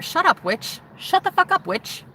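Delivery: high mocking voice of the church men. Men in the group telling her to shut up, high mocking sneer. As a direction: sneer it out like a bullying man. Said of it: "I was told that".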